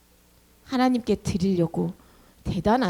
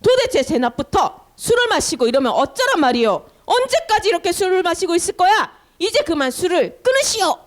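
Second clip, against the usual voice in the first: acted high, raised-voice telling-off; stern scolding; 7 semitones higher, 4 dB louder than usual. Priest scolding Hannah for seeming drunk, loud stern rebuke. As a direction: scold hard as an indignant priest. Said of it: "하고 막 혼냈어요".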